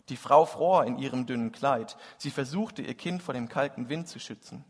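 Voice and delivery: lively storytelling, warm and vivid; reading a Christmas story aloud; story narration. A narrator's reading. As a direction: tell the tale to the congregation. Preacher reads Tolstoy story aloud.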